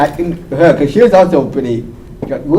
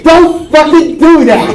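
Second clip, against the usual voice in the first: high slow scolding; 14 semitones higher, 4 dB louder, much slower than usual. Retold or imitated. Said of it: "told her".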